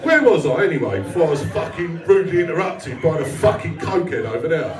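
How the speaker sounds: usual speaking voice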